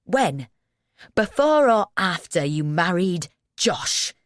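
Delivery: furious. A narrator's reading. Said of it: "He's really furious".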